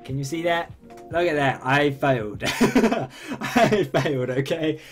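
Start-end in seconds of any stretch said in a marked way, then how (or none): none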